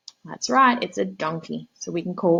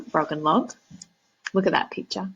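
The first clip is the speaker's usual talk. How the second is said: usual speaking voice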